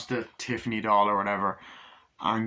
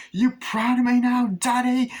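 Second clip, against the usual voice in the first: put-on very high and loud childish squeal; shrill [name]; 13 semitones higher, 7 dB louder, slower than usual. [name] asking his father for praise, very high squeal. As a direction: squeal it like a desperate kid.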